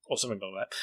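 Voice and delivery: usual speaking voice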